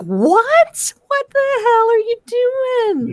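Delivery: high incredulous what the hell; exasperated disbelief. Retold or imitated. Friend baffled by Dana, high exasperated disbelief. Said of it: "I'm like".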